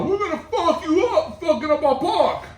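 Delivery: high-pitched imitated holler; mock threatening holler. Skinheads threatening the graffiti kids, high mock holler. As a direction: strain it high like a distant threat.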